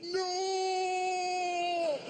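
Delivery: high, desperate mock scream; hammed-up cry of terror; anguished cry. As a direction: shriek it like the sky is exploding. Crowd blown back by fireworks.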